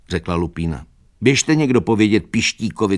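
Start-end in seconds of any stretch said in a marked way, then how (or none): 1.2-3.0 higher-pitched, pressing instruction; urgent order. A narrator's reading. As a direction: send someone running with the news. Lupina sends someone for help. (naléhavý pokyn, zvýšeným hlasem)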